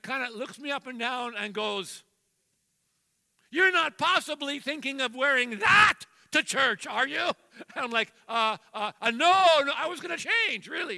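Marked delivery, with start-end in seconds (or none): 3.5-7.3 loud, raised disbelieving wife; hammed-up scandalized wife voice; wife's scolding. Wife scolds husband before church. 8.3-11.0 high, loud, stammering backpedal; mock panicked husband; flustered excuse.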